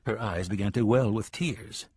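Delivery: usual speaking voice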